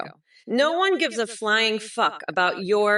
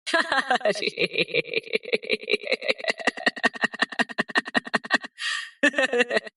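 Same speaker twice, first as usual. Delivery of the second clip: long cackling laugh; 4 semitones higher than usual. Real, not acted. Long laugh after taunting Trump.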